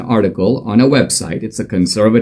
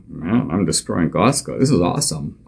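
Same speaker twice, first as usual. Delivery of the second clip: inner voice; 5 semitones lower, 7 dB quieter than usual. Retold or imitated.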